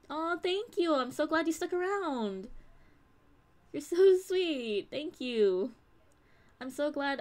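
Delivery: high, sweet, heartfelt gushing; gushing thanks. Real, not acted. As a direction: coo your thanks to a loyal fan. Gushing thanks to a loyal viewer.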